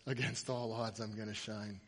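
low, soft, subdued recital; quiet quoting. Acted. Owner telling the player what his tattoo says, quiet. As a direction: drop it right down.

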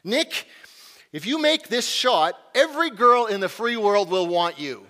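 imitating a coach yelling advice to his player; mock coach yell. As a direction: call it out like a coach from the bench. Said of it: "my coach yells this out".

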